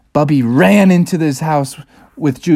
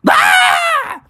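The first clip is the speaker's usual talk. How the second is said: high-pitched scream of fright; shouted scream. Acted. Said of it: "they both shouted".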